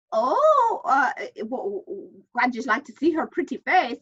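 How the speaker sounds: a hint of an Irish priest, taken aback; the priest recovering and asking again; Irish priest accent. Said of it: "Father Fitzgerald said".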